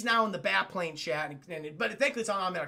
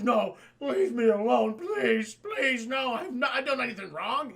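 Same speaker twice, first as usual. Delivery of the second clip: higher, pleading whine of a cornered villain; mock panic; 4 semitones higher, 4 dB louder, slower than usual. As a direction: beg for mercy, hammed up. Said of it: "He's fucking panicking".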